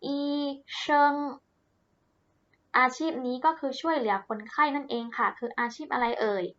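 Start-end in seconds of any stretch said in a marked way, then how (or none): 0.0-1.1 อี เชิง as two separate, even syllables; model pronunciation. Shown as a demonstration. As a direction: sound out อี, then เชิง, carefully for learners. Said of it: "ออกเสียงตามเหล่าชื่อนะคะ".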